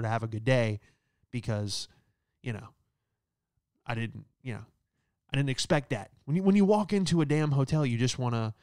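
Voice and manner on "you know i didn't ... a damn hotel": ordinary talk, nothing marked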